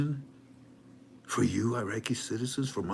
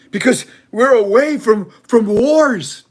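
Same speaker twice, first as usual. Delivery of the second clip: much higher, louder squeaky voice; high-pitched minister; 11 semitones higher, 13 dB louder, slower than usual. Retold or imitated. The minister tells his citizens, boasting in a squeaky voice. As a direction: squeak it proudly like a boasting politician. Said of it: "he says to them".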